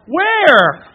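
very loud, high, drawn-out reaction; startled yell. Acted. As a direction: shout back in instant, irresistible curiosity. Acted reaction to yelled warning.

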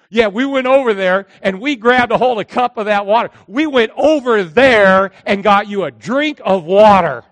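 loud, proud retold report; excited boasting. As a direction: boast loudly as the triumphant soldier, stretching the big words. Soldiers proudly report their exploit.

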